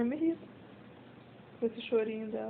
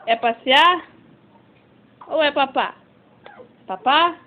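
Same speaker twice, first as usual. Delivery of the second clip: louder, rising teasing questions; pet talk; 3 semitones higher, 6 dB louder than usual. Acted. Owner asking her dog to pick walk or food, excited pet talk.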